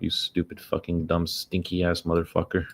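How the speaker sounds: ordinary delivery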